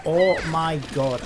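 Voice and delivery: loud drawn-out fed-up cry; exasperated yell. Real, not acted. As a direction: let the frustration burst out. Raging after dying to boss.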